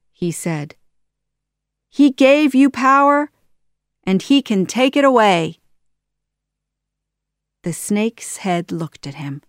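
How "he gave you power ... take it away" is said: goading taunt. Acted. Aladdin goads Jafar.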